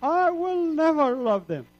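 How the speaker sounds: loud, high scream of refusal. Retold or imitated. Young man jumping from his seat, screaming his refusal. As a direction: yell it like you jumped off your seat. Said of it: "I screamed".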